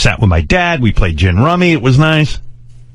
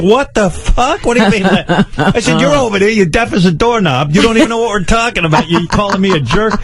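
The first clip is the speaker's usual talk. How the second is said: high-pitched, indignant retort; exasperated outburst; 7 semitones higher than usual. Retold or imitated. Grown child answering back to deaf mother, exasperated. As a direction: re-enact an incredulous tirade at mother.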